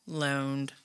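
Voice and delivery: "Loaned" repeated softly with dry irony; wry echo. Shown as a demonstration. Reading the article, echoing "Loaned" with dry irony. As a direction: let "Loaned" land low and dry, a skeptical aside.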